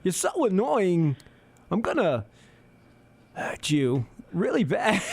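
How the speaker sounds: slow, eerily calm menace; mock Walken calmly threatening someone; calm slow threat. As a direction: threaten slowly and gently. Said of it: "he's like".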